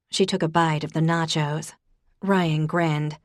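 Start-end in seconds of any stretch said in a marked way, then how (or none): none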